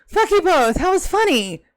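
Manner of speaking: high, loud complaint at an unamused audience; indignant protest. Real, not acted. Protesting that the joke was funny.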